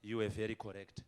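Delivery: mild apology. Retold or imitated. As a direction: say sorry to her, a bit sheepish. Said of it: "I would come and say".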